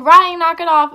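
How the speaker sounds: loud, sharp mock telling-off; imitated scolding mom. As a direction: call out like an exasperated mother. Mom scolding brother in retold scene.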